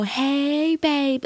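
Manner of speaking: a guy leaning out calling to a woman; mock catcall. Mocking a catcaller, high and breathy-free. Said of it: "We don't go".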